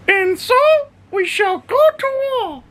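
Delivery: very high, grand squeaky war declaration; high-pitched dog voice. Pretend. Owner voices dog Bodhi declaring war, very high squeaky voice. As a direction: squeak it like a tiny warlord. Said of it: "he goes".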